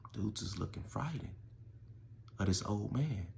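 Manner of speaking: suddenly soft storytelling; dramatic hush. Retold or imitated. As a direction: drop way down for the ominous reveal.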